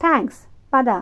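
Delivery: plain reading voice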